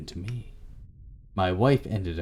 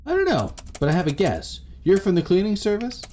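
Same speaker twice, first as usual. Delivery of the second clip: high, louder questioning voice; high-pitched guess; 10 semitones higher, 4 dB louder than usual. A narrator's reading. Answering the stranger at the door with a guess, high-pitched. Acted doorstep exchange in story.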